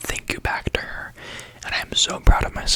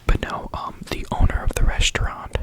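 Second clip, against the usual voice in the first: ordinary talk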